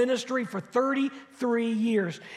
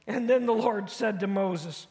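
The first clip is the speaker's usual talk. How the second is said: usual speaking voice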